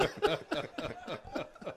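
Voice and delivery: high giggle at own punchline; high laugh. Real, not acted. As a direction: burst out laughing. Teller laughs after punchline.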